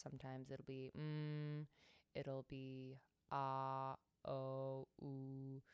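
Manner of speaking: soft vowel tones; mm, ah, oh, ooh voiced softly; tone demos. Shown as a demonstration. Teacher demonstrating soft vowel tones. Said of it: "round my lips".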